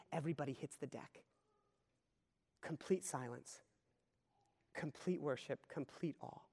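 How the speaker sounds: hushed, reverent description; hushed reverence. Real, not acted. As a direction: speak as if the room has fallen silent. Describing silent worship.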